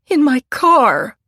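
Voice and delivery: high, loud, slow pleading; terrified woman answering the police; frightened. A narrator's reading. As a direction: plead in panic.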